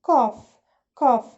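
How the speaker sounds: "cough" with a clear final F sound; loud, crisp model of "cough" ending in F. Shown as a demonstration. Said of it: "الجي اتش بتتنطق فا".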